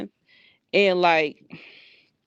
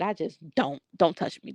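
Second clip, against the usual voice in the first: ordinary delivery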